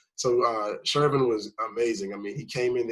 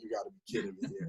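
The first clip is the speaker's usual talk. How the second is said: muffled into pillow; 3 semitones lower, 11 dB quieter, faster than usual. Retold or imitated. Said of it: "about to just, like, scream".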